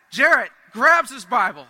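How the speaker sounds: normal talking voice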